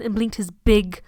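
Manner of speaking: ordinary talk, nothing marked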